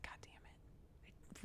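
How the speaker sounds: slow unvoiced curse under the breath; whispered curse. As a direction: hiss it slowly to yourself. Reacting to the book's advice.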